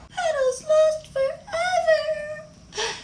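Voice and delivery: very high, drawn-out cry; acted sobbing despair; tearful wail. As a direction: wail it like a heartbroken little child. Worried child loses her doll.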